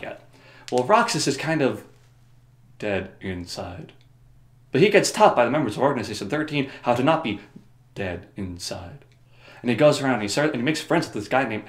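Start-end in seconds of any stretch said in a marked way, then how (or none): 2.8-3.9 low, slow, quiet monotone; deadpan. Shown as a demonstration. Describing an empty-hearted character, deadpan. Deadpan punchline on Roxas's emptiness. 7.9-9.0 low, slow, quiet monotone; deadpan. Shown as a demonstration. Describing an empty-hearted character, deadpan.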